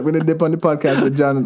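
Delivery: amused, broad Jamaican patois. Acted. Guest answering when the change happened, in thick Jamaican accent. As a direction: play up the Jamaican patois phrasing. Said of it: "just gonna go deeper into it".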